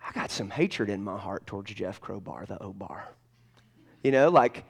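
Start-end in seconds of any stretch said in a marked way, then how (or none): 0.1-3.1 hushed, breathless whisper; confessing whisper. Real, not acted. Preacher confessing old hatred to the congregation, whispering.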